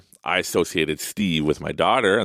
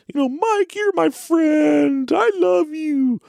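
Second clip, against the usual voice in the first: very high, slow and loud toy voice; Steve the hippo voice; 5 dB louder, much slower than usual. Retold or imitated. Dad imitating daughter's hippo declaring love, very high and loud. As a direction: belt out the love like a cartoon hippo. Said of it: "she'd do the voice".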